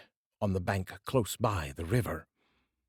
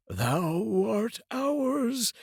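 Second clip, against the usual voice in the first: much higher, slow, ghostly clinging voices; haunting spirits who won't let go; eerie unlucky Days; 13 semitones higher, much slower than usual. Acted. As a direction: spirits taunting the man they cling to. The Days cling to rich brother.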